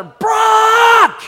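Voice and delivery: long, high screamed name. Retold or imitated. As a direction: scream it out and stretch the vowel. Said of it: "scream just a little bit louder".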